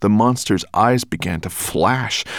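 ordinary delivery

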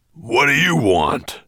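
grumpy master, loud and slow. A narrator's reading. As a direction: demand it with impatient, high-pitched grumpiness. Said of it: "asked Twigleg's master grumpily".

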